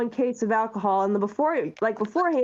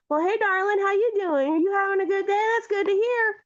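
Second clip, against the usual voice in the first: put-on flirty Southern belle accent, pitched up; 8 semitones higher than usual. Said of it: "talking with this really sick butter-, Southern accent".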